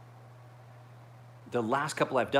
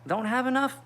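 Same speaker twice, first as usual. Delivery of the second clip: high teasing mock voice; a squeaky mocking friend; imitating Elliot. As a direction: go way up to poke fun at his strength. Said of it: "He's like".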